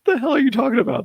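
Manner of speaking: high, fast, loud incredulous blurt; hammed-up indignant retort; mock outrage. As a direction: snap back in fake disbelief at the question. Mock outrage at own question.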